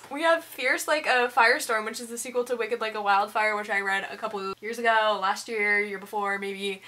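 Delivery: ordinary talk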